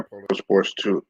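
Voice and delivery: talking with a mouthful of food; words mumbled round a bite; mouth full. Real, not acted. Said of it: "Had some food in my mouth".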